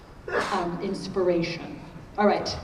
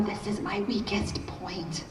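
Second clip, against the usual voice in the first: slow stage whisper; hushed, drawn-out admission; confessional whisper; 5 dB quieter, slower than usual. Acted. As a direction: whisper it slowly, like an embarrassing confession. Confesses a weakness as aside.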